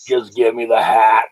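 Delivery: put-on low old-woman voice; old lady demand. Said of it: "she was like".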